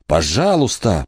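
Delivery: plain reading voice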